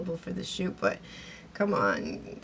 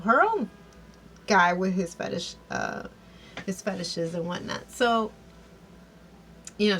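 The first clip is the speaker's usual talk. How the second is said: ordinary delivery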